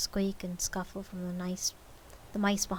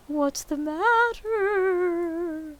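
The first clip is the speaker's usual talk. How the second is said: spirit voice; 11 semitones higher, 9 dB louder, much slower than usual. A narrator's reading.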